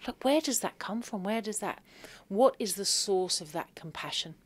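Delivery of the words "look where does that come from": normal talking voice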